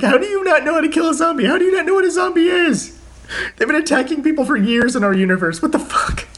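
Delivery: high-pitched incredulous mock rant; Frank West flipping out. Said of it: "just him flipping out".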